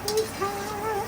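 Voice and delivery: high, laughing squeal of it's hot; giggling yelp at the heat. Real, not acted. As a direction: laugh through it after touching the hot pan. Burning hands on hot shrimp.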